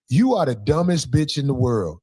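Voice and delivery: normal talking voice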